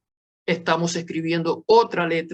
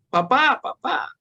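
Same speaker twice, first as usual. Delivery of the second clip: imitated yell; 8 semitones higher than usual. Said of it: "le gritaba".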